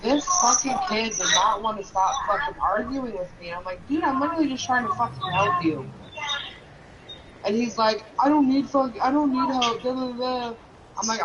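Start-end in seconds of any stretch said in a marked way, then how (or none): 3.9-5.9 exasperated retort. Retold or imitated. Retold argument with son. 8.2-10.5 mock whiny kid. Imitating son refusing help.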